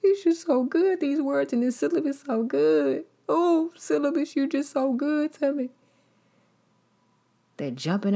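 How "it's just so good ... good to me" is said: high, whiny, hammed-up gushing; mock crying student. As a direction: overplay a tearful fan of the syllabus.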